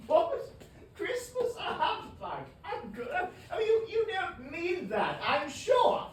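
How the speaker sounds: high, loud, laughing protest; laughing disbelief. Acted. Nephew Fred teasing his grumpy uncle, high and laughing. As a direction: laugh him out of his gloom.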